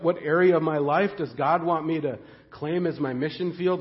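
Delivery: ordinary talk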